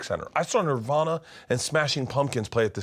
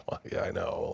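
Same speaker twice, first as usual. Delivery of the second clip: sheepish whisper; 7 dB quieter than usual. Retold or imitated. Sheepish reply to teacher.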